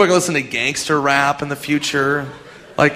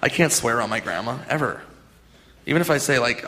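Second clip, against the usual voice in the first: usual speaking voice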